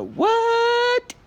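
falsetto squeak. Acted. Sudden squeak.

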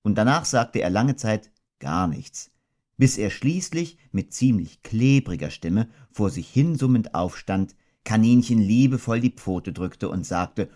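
ordinary delivery

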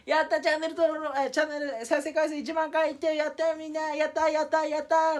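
high, bright celebratory whoops; excited cheering. Retold or imitated. Showing the viewers his milestone, high excited cheer. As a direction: cheer like you just hit the goal. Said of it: "って感じで".

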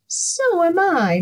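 high, meowy cat voice; meowing cat. A narrator's reading. Cat joining the hungry animals, high meow. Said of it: "meowed the cat".

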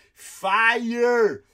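high, loud yell of fire; imitated shout. Comedian mimicking a moviegoer yelling in a cinema, loud shout. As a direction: bellow it like the guy in the front row. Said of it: "He just kept going".

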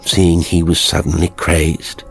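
ordinary delivery, nothing marked

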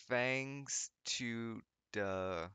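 very slow, word-by-word sounding out; laboured reading. A narrator's reading. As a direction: drag out each misspelt word as written. Sounding out misspelled author's note.

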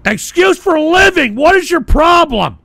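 a sudden hostile jab at the caller; angry outburst. Real, not acted. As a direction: snap back at the caller. Host turns on caller.

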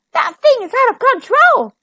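high, loud panicked exclamation; alarmed storybook cry; gasping alarm. A narrator's reading. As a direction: blurt it out aghast. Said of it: "gasped Streaky".

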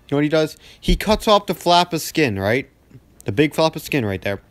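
usual speaking voice

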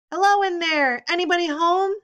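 loud high hollering; shouting. A narrator's reading. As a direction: call out loud from the hallway. Shouting in the corridor.

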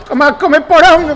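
taunting the rival after a landed combo; gloating taunt. Real, not acted. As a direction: crow over the hit. Taunting opponent after a hit.

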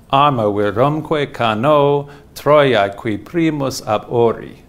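slow chanted Latin hexameter; Latin verse recitation. Shown as a demonstration. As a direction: recite the Latin slowly, in meter. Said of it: "so it'll be".

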